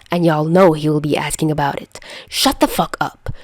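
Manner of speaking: ordinary delivery, nothing marked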